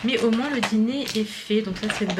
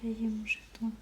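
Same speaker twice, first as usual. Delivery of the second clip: speaking softly; 3 semitones lower, 19 dB quieter than usual. Real, not acted. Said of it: "je parle doucement parce que Ali fait dodo".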